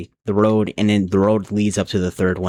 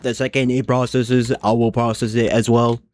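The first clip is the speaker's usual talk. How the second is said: stiff mechanical robot speech. Shown as a demonstration. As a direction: talk like a robot. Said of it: "I'm talking like a robot".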